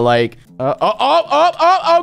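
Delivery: ordinary talk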